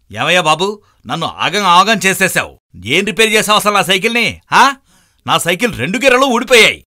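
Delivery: high, loud accusing shout; angry complaint. Acted. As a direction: confront the bad repairman. Cyclist confronts repairman.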